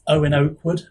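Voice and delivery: usual speaking voice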